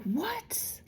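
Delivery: breathless, whispered "What?" high up; stunned whisper. Retold or imitated. Stunned at the angels, a whispered high "What?". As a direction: gasp the word out in disbelief. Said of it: "And I'm like".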